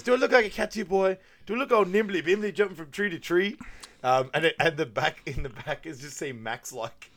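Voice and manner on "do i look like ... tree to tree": high, loud mock outrage; shouting cop. Cop yelling at the driver, loud and high. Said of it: "he goes".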